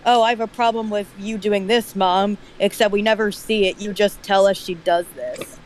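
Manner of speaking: ordinary talk, nothing marked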